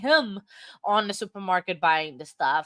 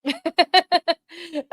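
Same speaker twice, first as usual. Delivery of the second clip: loud laugh; 8 semitones higher, 6 dB louder than usual. Real, not acted. Laughing at imposter cat joke.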